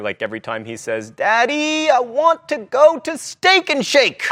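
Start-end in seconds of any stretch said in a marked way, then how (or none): 1.1-4.3 imitating toddler. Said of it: "My two-year-old son".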